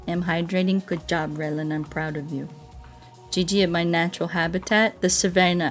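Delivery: ordinary delivery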